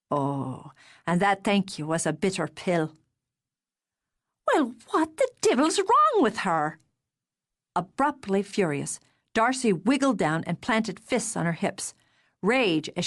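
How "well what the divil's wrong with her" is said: higher, sharper indignant outburst; indignant sister. Acted. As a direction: bristle on his behalf. Darcy abruptly furious.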